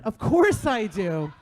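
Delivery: slow, laughing "of course"; laughing forced reassurance. Pretend. Mom forcing cheer for her son, slow and laughing. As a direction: push the reassurance through a laugh.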